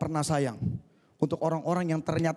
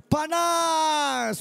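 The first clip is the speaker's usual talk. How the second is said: high, loud yell of pain; tea screaming; 12 semitones higher, 4 dB louder than usual. Pretend. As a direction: cry out like scalded tea. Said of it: "kalau teh bisa ngomong dia teriak apa?".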